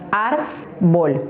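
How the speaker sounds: "árbol" split into ar and bol with a pause between; Silbentrennung. Shown as a demonstration. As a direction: separate the two syllables of "árbol". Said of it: "tiene dos sílabas".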